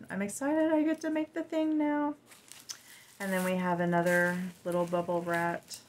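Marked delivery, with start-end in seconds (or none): 0.1-2.1 high-pitched, eager excitement; excited. Real, not acted. Crafter thrilled to finally make the ointment, excited. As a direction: lift it high with real excitement.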